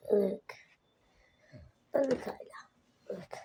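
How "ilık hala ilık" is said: whispering. Real, not acted. Whispered hint.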